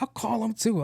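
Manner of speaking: very high mock retort; retold taunting comeback.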